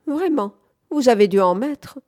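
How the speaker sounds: usual speaking voice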